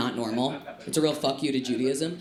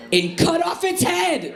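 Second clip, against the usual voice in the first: raised, loud shout; shouted disbelief; 12 semitones higher, 5 dB louder, slower than usual. Acted. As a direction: shout the outrageous demand in disbelief. Shouted punchline of outrageous demand.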